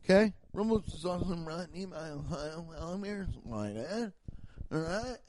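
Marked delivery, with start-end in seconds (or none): 0.5-4.1 soft read. Shown as a demonstration. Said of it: "mock ad reads".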